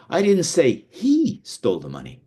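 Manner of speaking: "he" lifted high and stretched; single out "he", drop the rest; stress on HE. Shown as a demonstration. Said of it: "by how you say each individual word".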